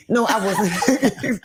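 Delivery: slow, high, laughing mock denial. Real, not acted. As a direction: drag it out through laughter, pretending innocence. Jokingly denying being on bad list.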